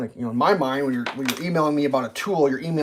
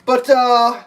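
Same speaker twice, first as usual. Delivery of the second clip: high, louder chipper agent; hammed-up customer service voice; mock rep voice; 9 semitones higher, 5 dB louder than usual. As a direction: play the clueless cheerful rep. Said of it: "they're like".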